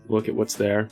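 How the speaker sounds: normal talking voice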